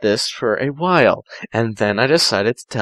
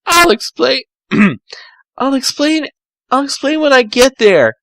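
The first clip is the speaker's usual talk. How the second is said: higher, hurried brush-off; higher Tails voice; 11 semitones higher than usual. Acted. Tails ending the call with Amy, pitched-up hurried voice. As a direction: promise to explain, pitched up. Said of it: "Tails said".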